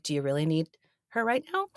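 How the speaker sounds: softly imitated protective question. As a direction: ask gently, a polite shield for a colleague. Gently shielding a busy colleague.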